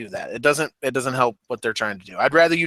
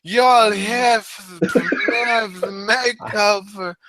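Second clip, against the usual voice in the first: slow, higher, drawn-out Australian Emma voice; mock Australian accent; 6 semitones higher, much slower than usual. Host mimicking Emma's promo in a slow, mock Australian accent. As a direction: parody her Australian promo, stretching every word. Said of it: "Is that your Australian accent?".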